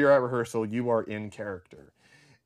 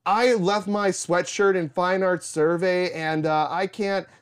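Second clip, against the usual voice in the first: flustered student; 6 semitones higher, 5 dB louder than usual. Retold or imitated. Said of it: "And I go".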